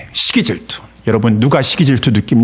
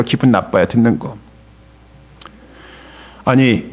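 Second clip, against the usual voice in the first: usual speaking voice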